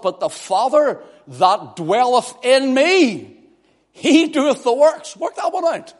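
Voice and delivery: high, loud preaching building to a shout; preaching at full force. Real, not acted. Preacher quoting Jesus to the congregation, full-force preaching. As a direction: proclaim scripture with rising force, high and loud.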